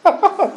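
sudden high burst of laughter; a quick real laugh in the middle of a sentence. Real, not acted. Preacher laughs at his own story.

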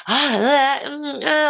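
mock pining whimper; strained whimper. Shown as a demonstration. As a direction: whimper like you're aching for it. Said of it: "This does not mean being".

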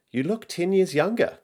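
put-on high-pitched little girl voice; child's high, admiring voice; young daughter. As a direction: lift it high like a child paying a compliment. Narrator voices her young daughter's compliment.

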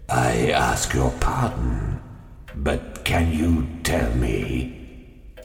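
low, slow menacing stranger; low looming threat. A narrator's reading. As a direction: lean in, low and ominous.

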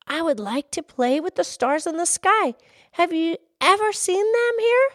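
high, hopeful lass asking; high-pitched child character, rising question; little girl. Acted. Little girl voiced in story.

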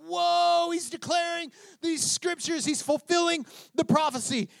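high-pitched awe, acting out onlookers; amazed crowd. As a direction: marvel aloud as a stunned listener.

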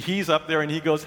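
ordinary talk

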